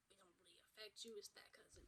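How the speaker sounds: quick dismissive whisper; whispered aside. Real, not acted. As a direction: toss it off under your breath. Dismissive aside about distant cousin.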